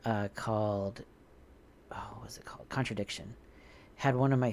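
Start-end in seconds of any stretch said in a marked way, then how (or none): 1.9-2.7 whispering. Real, not acted. Whispered aside, searching memory.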